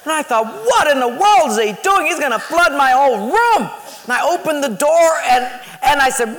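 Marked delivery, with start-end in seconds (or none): 0.5-3.7 loud, high-pitched retold outrage; outraged disbelief. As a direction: exclaim in indignant alarm at the flood. Retold outrage at flooded room.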